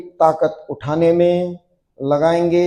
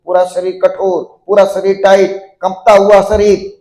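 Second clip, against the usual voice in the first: steady chant-like commands building louder to the release; building yoga drill; 2 semitones higher, 4 dB louder than usual. Acted. Yoga teacher building students' tension before the drop, mounting drill cueing. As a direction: drive the tension up, then call the drop.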